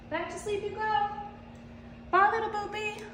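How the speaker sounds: soft, high sing-song farewell; tiny, tender high voice. Real, not acted. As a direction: coo a sleepy cat back to bed. Saying goodbye to sleepy cat.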